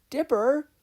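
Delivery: high, loud call of the name; shouted name. Acted. Sister shouting to her trapped brother, alarmed call. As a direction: cry out his name in alarm.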